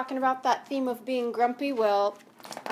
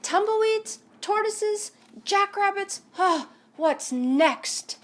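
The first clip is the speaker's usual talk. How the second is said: higher, louder fed-up list; exasperated grumble; 9 semitones higher, 5 dB louder, slower than usual. Acted. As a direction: sound fed up with all the visitors. Hank fed up with visitors.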